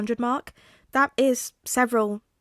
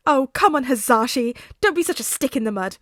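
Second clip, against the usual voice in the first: louder, higher teasing coax; playful teasing; 4 semitones higher, 7 dB louder than usual. Acted. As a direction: needle him playfully. Tensei teasing Hazashi.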